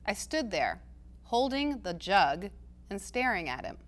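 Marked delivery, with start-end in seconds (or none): none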